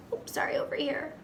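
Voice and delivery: quick whispered aside; rushed hushed "over here". Real, not acted. Quick whispered correction to children.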